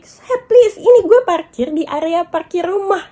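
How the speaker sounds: retold protest. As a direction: push back at the stranger, exasperated.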